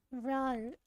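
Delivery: normal talking voice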